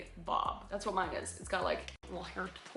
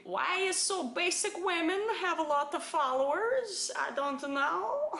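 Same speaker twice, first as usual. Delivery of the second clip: higher, sing-song broken English; exaggerated broken-English accent, pitched up; 8 semitones higher, 4 dB louder than usual. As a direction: put on a foreign broken-English voice. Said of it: "It's the broken English for me".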